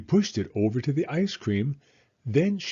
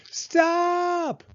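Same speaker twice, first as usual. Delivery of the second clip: high shrill-free cry, much higher; yelled stop; 4 dB louder than usual. A narrator's reading. Girl yelling at the man lifting her by the hair, high cry. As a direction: cry out to make him let go. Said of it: "Taya yelled as loudly as she could".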